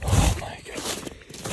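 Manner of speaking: slow, stunned whisper; hushed disbelief; awed whisper. Real, not acted. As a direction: breathe it out in disbelief. Hunter sees the downed buck.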